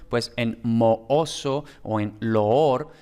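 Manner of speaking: mooso and loor said slowly, each double vowel held as two vowels; separate equal vowels. Shown as a demonstration. Teacher demonstrating hiatus, slow and drawn out. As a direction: stretch out the oo in mooso and loor without merging them. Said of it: "pues las vocales iguales".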